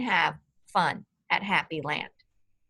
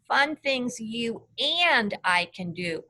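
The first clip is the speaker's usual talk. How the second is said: "and" stretched out and lifted in a slower line; stress on AND. Shown as a demonstration. Teacher showing the class Hot Rod's emphasis, stress on "and". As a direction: hit the word "and" hard, drawing it out. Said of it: "Hot Rod emphasized the word".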